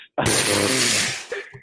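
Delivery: short laugh after a gripe. Real, not acted. Dodging talk of his team's loss, laughing. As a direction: laugh off the sore subject.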